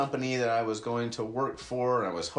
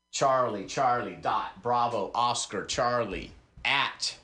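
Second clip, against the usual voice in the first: usual speaking voice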